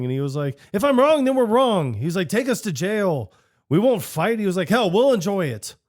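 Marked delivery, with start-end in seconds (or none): 0.7-1.9 high, loud brash declaration; mock bold declaration. Pete pitching to the mayor, high loud brash declaration. 2.3-5.8 high cocky dare; cocky mock bravado. Pete daring the mayor, high cocky mock bravado.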